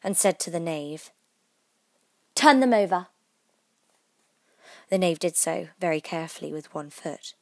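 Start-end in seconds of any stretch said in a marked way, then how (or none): 2.3-3.1 louder, higher imperious command; sharp, angry queen; angry order. A narrator's reading. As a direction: order it angrily. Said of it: "The Queen turned angrily away".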